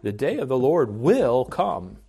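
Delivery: "will" lifted out as the key word; stress on WILL. Shown as a demonstration. Preacher repeats verse to show its emphasis, stress on "will". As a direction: hit "will" to show certainty. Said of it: "that's clearly the emphasis".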